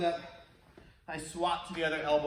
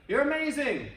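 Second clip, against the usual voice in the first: cheerful call out; 7 semitones higher, 3 dB louder than usual. Retold or imitated. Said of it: "they went out and they shouted".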